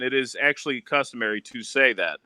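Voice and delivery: ordinary talk, nothing marked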